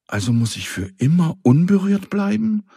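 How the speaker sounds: a house begging not to stay untouched forever; despairing plea. Acted. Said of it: "flüsterte Rauru verzweifelt". (verzweifelt flehend, viel höher)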